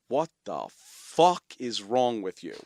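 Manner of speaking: slow, gruff imitation of dad; mock his dad's disbelief, drawn out; slow incredulous dad voice; dad's blunt retort. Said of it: "and he goes".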